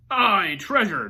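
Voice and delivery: crew shouting. A narrator's reading. Pirate crew cheers about treasure, loud shout. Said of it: "the others shouted".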